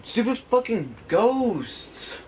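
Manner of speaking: real exasperation boiling over; frustrated yell. Real, not acted. Streamer rages at ghosts knocking things over, angry yell.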